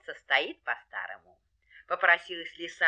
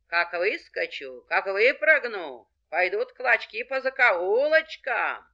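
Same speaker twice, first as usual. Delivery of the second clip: slow, drawn-out threat, rising at the end; threatening fox; 4 dB louder than usual. Acted. Fox scares off the dogs, menacing. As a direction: stretch each menace out, building to the last.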